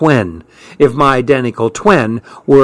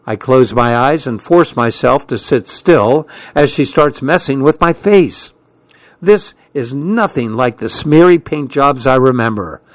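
usual speaking voice

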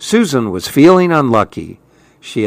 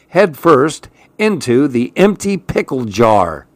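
ordinary delivery, nothing marked

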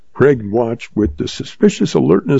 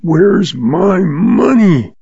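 slow, rasping demand with a higher pitch; 7 semitones higher, much slower than usual. A narrator's reading. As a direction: drag out each word in a rasp. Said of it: "rasped Lem Craig".

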